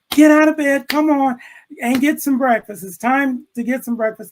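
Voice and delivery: high, loud impatient mother calling; mom yelling upstairs. Retold or imitated. Mother calling her son down to breakfast, loud and high. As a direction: yell him out of bed, losing patience.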